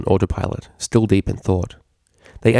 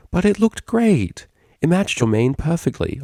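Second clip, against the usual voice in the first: a touch higher, slow, eager and persistent; lightly voiced Octavia; 9 semitones higher than usual. Octavia urging her roommate to keep the beanie, eager. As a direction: coax her friend into the hat.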